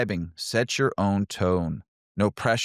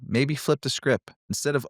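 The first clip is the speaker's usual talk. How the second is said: ordinary talk, nothing marked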